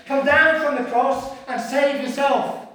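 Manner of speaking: loud, high jeering; scornful, humiliating heckle; mocking sarcasm. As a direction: hurl it like a taunting passer-by. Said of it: "That's sarcasm. That's humiliation. That's mocking and insults".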